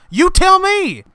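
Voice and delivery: high, loud exasperated snap; exasperated retort. Acted. Ranting at the card machine, high loud snap. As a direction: throw it back at the machine.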